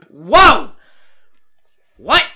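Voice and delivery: loud, high acted "whoa" of confusion; hammed-up bewildered shout; dumbfounded outburst. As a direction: act out a baffled man yelling in shock. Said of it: "and Miz just goes".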